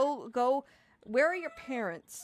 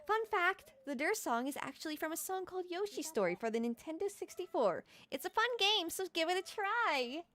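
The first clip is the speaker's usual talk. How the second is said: much higher, chirpy announcer lilt; high bubbly fun-fact voice; 7 semitones higher than usual. Real, not acted. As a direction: sell the fun fact brightly. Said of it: "I don't know why I'm so ch".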